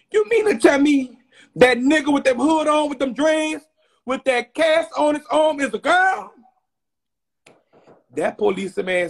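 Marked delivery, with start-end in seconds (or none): 0.1-6.3 high, loud incredulous question; can't believe the suspect is a girl; outraged disbelief. Retold or imitated. Questioning the police in loud disbelief. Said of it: "Wait a minute".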